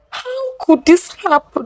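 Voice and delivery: high, pained disbelief; anguished question. A narrator's reading. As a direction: ask it as if the ground gave way. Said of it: "Nneka asked".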